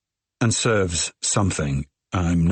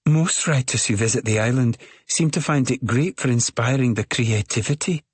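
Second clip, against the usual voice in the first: put-on higher, bubbly thick Scottish accent; 5 semitones higher than usual. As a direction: gush with a thick Scottish lilt. Midge keeps talking.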